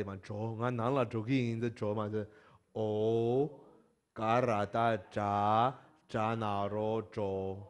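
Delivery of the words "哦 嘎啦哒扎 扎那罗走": very slow recitation of each letter; slow spelling out. Shown as a demonstration. Teacher showing students how to spell, slow. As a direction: chant the spelling slowly. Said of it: "就这样哦".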